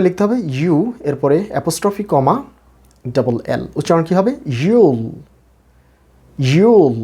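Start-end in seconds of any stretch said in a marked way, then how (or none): 4.6-7.0 you'll with a drawn-out long oo; long vowel. Shown as a demonstration. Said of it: "এবং long sound করতে হবে".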